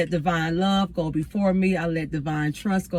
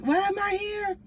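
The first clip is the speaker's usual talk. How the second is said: high, thin questioning voice; retold bewilderment; 13 semitones higher, 4 dB quieter than usual. Woman alone in hotel to cry, high bewildered question. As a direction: pitch it way up, puzzled at yourself.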